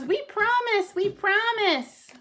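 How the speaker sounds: very high pleading cub voice; excited little bear cubs begging; eager crying cubs. A narrator's reading. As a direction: promise it high, drawing out the last one. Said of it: "cried the cubs".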